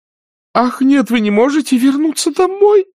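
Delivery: put-on high, loud distressed old aunt; tearful exclamation. A narrator's reading. Aunt Pittypat cries out begging Scarlett not to leave. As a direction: cry out on the edge of tears. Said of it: "воскликнула тётушка Питтипэт и расплакалась".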